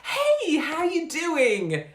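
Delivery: very high, loud, slow gushing hello; beaming, exaggerated hello; tsunami of love. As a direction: drown them in over-the-top friendliness. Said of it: "and you go".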